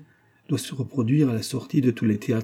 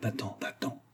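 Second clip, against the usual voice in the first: very soft, furtive plea; whispered urging; 12 dB quieter than usual. A narrator's reading. Antonine sending her lover away behind mother's back, whispered. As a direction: whisper it so nobody else hears. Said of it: "chuchota Antonine".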